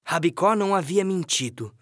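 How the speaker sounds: normal talking voice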